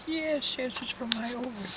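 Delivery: put-on high, eager voice of an old patient; high frail woman. Sick old woman answering the doctor, high and eager. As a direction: pipe it up like a frail old lady.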